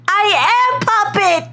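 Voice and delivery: ordinary talk, nothing marked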